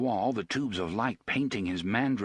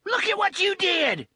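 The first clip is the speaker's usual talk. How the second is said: much higher, loud shriek; Tilly screams at the stabbing; 6 dB louder than usual. Acted. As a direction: shriek in horror. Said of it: "Tilly screamed".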